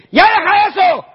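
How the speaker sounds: hammed-up stern command; mock harsh shout. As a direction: bark it like an impatient boss. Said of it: "No, no lo va a hacer así".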